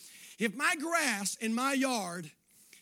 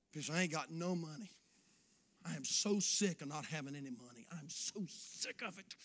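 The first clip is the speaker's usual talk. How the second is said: low, quiet self-pitying moan; mock moaning; 10 semitones lower, 10 dB quieter than usual. Preacher imitating a broke complainer, quiet self-pitying moan. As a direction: sulk it out under your breath.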